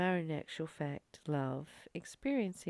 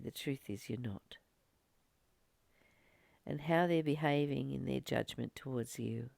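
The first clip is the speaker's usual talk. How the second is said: gentle, unhurried spiritual talk. Acted. Guided reflection to viewers.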